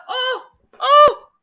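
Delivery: sudden high, loud panicked oh; real startled cry at a spill; frantic, high-pitched alarm; panicked yelp. Real, not acted. Spills tea on computer.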